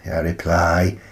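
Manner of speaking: usual speaking voice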